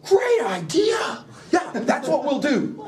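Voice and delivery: hammed-up gullible yes-man; dim eager Macbeth. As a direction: play him as a thick soldier, thrilled. Macbeth eagerly agrees to plan.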